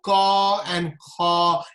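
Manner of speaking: "ka and ka" said slowly, unvoiced, no buzz in the throat. Shown as a demonstration. Teacher showing students the unvoiced consonants, slow and careful. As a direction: hold back the vocal cords on each "ka". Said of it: "you don't vibrate the vocal cord".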